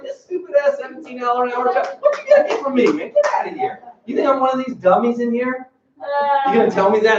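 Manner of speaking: high, scornful rant, peaking very high; mock rant. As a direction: rant at the boss like he's a con man. Imagined rant at the boss.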